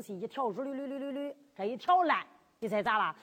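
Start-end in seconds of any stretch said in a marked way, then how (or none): none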